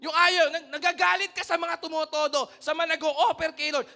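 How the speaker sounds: full-force preacher scolding; forceful preaching. Real, not acted. Preacher rebukes the congregation.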